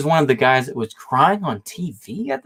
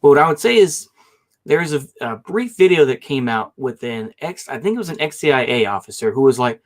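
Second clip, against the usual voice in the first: ordinary delivery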